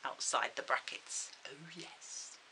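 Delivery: whispered, hushed villain tease; slow theatrical villain menace. Pretend. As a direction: breathe it like a pantomime villain. Teases a dastardly next topic.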